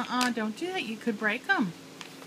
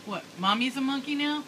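ordinary talk, nothing marked

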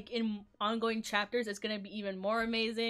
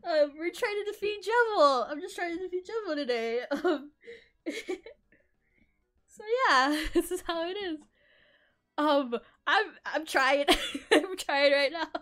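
high, loud laughing near tears; shrieking jackpot winner; 8 semitones higher, 6 dB louder than usual. Real, not acted. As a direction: gush through happy sobs. Still overwhelmed by the raid.

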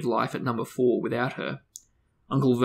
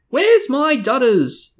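high, loud, slow roar; 13 semitones higher, 8 dB louder, slower than usual. A narrator's reading. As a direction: bellow it across the hall like a bossy aunt. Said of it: "roared Aunt Marge".

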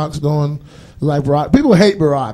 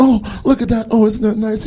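mock sweet girlfriend voice; 8 semitones higher than usual. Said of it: "couple window shopping".

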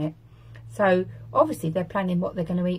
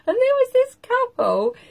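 high squeak; 6 dB louder than usual. Real, not acted. Squeaky laugh into story.

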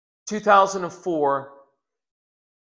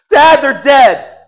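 high, loud panicked shouts; retold screaming; 7 semitones higher, 12 dB louder than usual. Daughter screaming down the phone to her dad. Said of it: "And she's screaming".

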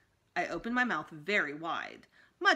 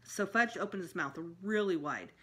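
plain reading voice

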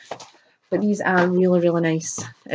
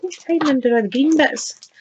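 playful surprise; 11 semitones higher, 3 dB louder than usual. Pretend. Unboxer finding something hiding under green bits, playful sing-song. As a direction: coo at it as if playing hide-and-seek. Playful discovery of a hidden item.

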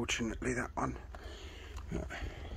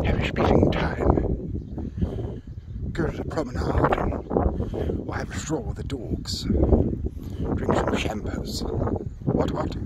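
hammed-up posh English toff, louder; posh accent; 5 semitones higher, 4 dB louder, slower than usual. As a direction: play a plummy upper-class eccentric for laughs. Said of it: "That's my posh accent thing".

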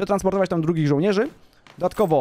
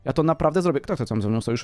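ordinary delivery

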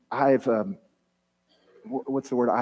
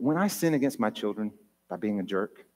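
ordinary talk, nothing marked

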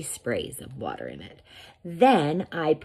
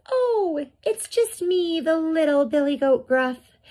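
very high, tiny goat voice; little billy goat; 13 semitones higher, 3 dB louder than usual. A narrator's reading. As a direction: squeak it like a small, harmless goat. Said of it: "And he says".

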